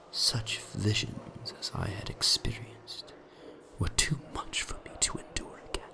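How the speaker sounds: hushed unvoiced whisper. A narrator's reading. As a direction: breathe it out in a frightened whisper. Narrator drops to whisper on dread.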